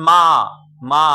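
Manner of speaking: মা as one loud, distinct syllable; single-syllable demonstration. Shown as a demonstration. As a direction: say মা in one firm burst. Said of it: "একটা উচ্চারণ করছি".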